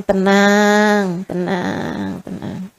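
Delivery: soothing. Retold or imitated. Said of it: "Saya bilang".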